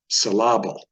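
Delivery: "syllable" stressed wrongly on the middle, sil-LAB-ul; misplaced stress. Shown as a demonstration. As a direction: punch the second syllable of "syllable". Deliberately wrong stress, spoken as joke.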